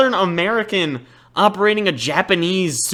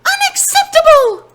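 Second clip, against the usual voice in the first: outraged shout; 7 dB louder than usual. Acted. Outraged stranger yelling in shop.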